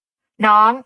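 loud, slow, confronting the vendor; ถามเสียงดัง; loud demand. A narrator's reading. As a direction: call her out loudly over the counter. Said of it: "ฉันถามเสียงดังใส่แม่ค้าขนม".

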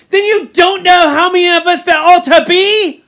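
high, loud accusing question; accusing challenge. A narrator's reading. Ralph challenges Piggy on the head count, loud and accusing. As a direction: throw the question at him loudly.

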